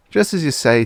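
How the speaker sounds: high, carefree agreeing; blithe clerk voice. A narrator's reading. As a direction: cheerfully agree without thinking.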